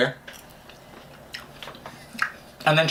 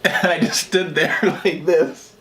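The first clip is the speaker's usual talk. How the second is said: slow, laughing through the words; can't stop laughing while telling it; slower than usual. Real, not acted. Telling friends the cake story, laughing through it.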